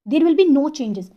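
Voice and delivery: ordinary talk, nothing marked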